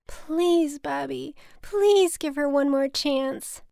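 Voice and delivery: high, drawn-out fake tearful plea; put-on crying. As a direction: beg with staged tears. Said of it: "so I decided to cry".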